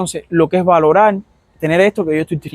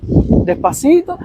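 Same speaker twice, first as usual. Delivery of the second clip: drawn out slowly; 11 semitones higher, much slower than usual. Retold or imitated. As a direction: stretch despacito very slow and high. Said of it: "yo veo a los médicos que van". (despacito alargado, lento y agudo)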